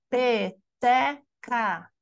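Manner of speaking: Peteca split slowly into pe-te-ca; syllables clapped out. Shown as a demonstration. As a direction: beat out each syllable of Peteca. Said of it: "que pode ser por palmas".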